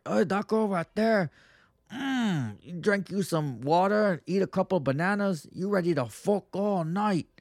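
imitated Cuban guy. A Cuban guy ogling a girl, in a lightly voiced leering accent. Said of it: "let's say you got a Cuban".

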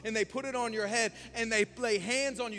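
loud, high preaching build; fiery preaching. Acted. Pastor preaching hard to the church, loud and high. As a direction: preach it out to the congregation.

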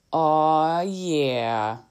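drawled, drawn-out imitation of someone's accent; imitated accent. Mom quoting her young son's impression of an accent, imitated accent. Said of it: "and they sound like this".

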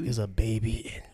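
secretive whisper. Acted. Whispering baby news.

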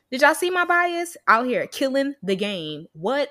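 ordinary talk

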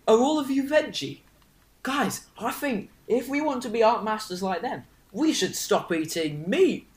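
put-on earnest, high-starting Northern English accent; rally the group in a Northern accent, slowing down; lilting Northern lad making a proposal; Harold's accent. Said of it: "It's Harold's accent".

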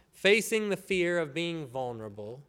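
ordinary talk

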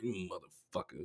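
very soft, low grumble; muttered grumble. Retold or imitated. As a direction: curse him under your breath. Acting out resentful private thought.

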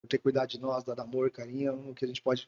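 voz baixa e grave, bem suave; homenagem terna. Retold or imitated. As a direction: reconte com carinho a fala de um filho à mãe. Filho entrega presente à mãe. (ternura contida, quase em tom de confidência)

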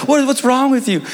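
high mock-incredulous teasing; making fun. Coach ribbing the freshman over his tan line, making fun. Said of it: "just making fun of him".

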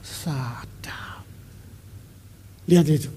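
whispered, drawn-out exclamation; hushed awe. Real, not acted. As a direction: breathe it out in an awed whisper. Savouring the verse just read.

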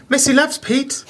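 high cutesy voice; third-person girlfriend. Retold or imitated. Mimicking his clingy ex-girlfriend, high cutesy coo. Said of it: "refer to herself in the third person".

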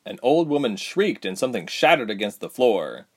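ordinary delivery, nothing marked

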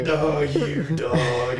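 drawn-out playful shout; loud slow protest. Acted. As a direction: stretch it out, loud. Refusing another drink, joking.

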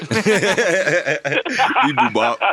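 high, slow, laughing robot bleep. Pretend. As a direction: drag out the beeps, high and giggling.

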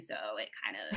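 very low creaky fry, speeding up; vocal fry. Shown as a demonstration. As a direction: drop into a deep creaky fry. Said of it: "but vocal fry is".

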